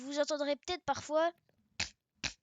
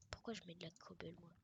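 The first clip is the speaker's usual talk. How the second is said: whispered puzzled question; baffled whisper; whispered aside; 5 semitones lower, 15 dB quieter, faster than usual. Real, not acted.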